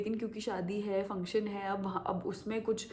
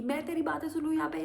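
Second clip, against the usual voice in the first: mock angry driver; 8 semitones higher, faster than usual. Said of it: "वो ऐसे बोल रहा था".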